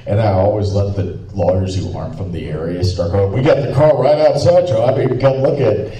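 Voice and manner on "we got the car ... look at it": raised, louder, eager courtroom pitch; eager pitching lawyer. Retold or imitated. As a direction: act out a lawyer piping up, higher and keen. Lawyers voiced calling out in story.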